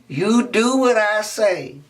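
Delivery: slow emphatic teacher; slow stern repeat. Acted. Miss Sarah repeats the order, slow and firm. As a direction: drag each word out firmly.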